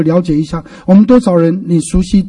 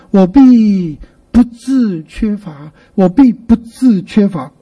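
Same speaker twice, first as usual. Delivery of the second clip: slow, each word of 必不致缺乏 set apart; deliberate weight on 必 and 不致; weighty stressed reading; much slower than usual. Shown as a demonstration. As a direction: spell out 必不致缺乏 slowly, with pauses. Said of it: "重点重重的读它一下".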